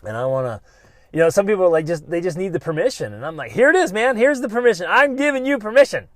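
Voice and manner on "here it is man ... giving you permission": much higher and louder, fired up; loud excited grant. Acted. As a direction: hand it over like a hype coach. Granting listeners permission.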